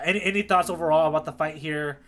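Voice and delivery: ordinary talk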